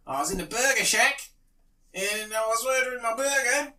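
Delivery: high-pitched Australian yarn-spinner; Australian accent. Pretend. Comic on stage telling his drop bear joke, Australian accent. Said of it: "pretend to be an Australian man".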